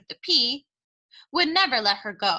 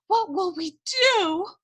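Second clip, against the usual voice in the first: distressed question; 9 semitones higher, slower than usual. Acted. Cook wailing, first take.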